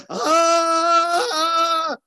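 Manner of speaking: high, loud scream of a woman on the floor; imitated woman's scream. Preacher imitating a woman falling and screaming, loud scream. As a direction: let out a sudden loud high ah. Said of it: "hacía".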